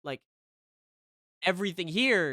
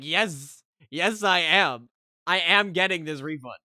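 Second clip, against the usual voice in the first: loud, emphatic pushback; defiant insistence; 6 dB louder than usual. Retold or imitated. Customer arguing with agent over a refund, loudly defiant. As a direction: dig in and refuse to budge.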